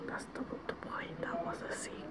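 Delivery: barely audible whisper; hushed whisper. Real, not acted. Vlogger reminding a friend it was secret, whispered.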